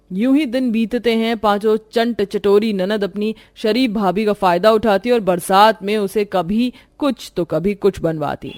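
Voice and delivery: plain reading voice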